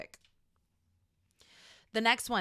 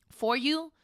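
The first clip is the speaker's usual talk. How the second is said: ordinary talk, nothing marked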